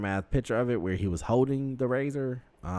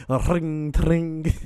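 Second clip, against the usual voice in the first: imitating a ring; 9 semitones higher, 8 dB louder than usual. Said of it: "All I hear is fucking".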